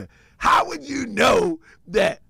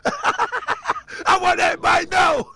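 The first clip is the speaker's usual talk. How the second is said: slow, laughing announcement, pitched up; 7 semitones higher, slower than usual. Real, not acted. Host laughing as he tells his audience. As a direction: laugh through the words.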